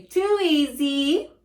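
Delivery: slow, loud, smug drawl. Shown as a demonstration. Teacher modelling a slow, cocky response for students. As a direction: savor it like it's nothing.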